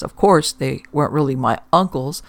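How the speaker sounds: usual speaking voice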